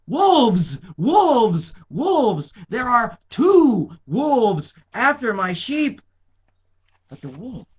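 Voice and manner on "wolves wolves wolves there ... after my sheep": high, loud panicked shouts; yelling wolves. A narrator's reading. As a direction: yell it across town.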